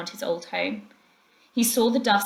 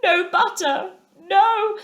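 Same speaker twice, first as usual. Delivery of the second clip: high, louder mournful groan; doleful groan; 11 semitones higher, 5 dB louder, slower than usual. A narrator's reading. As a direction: groan it out in gloom. Mole's miserable voice.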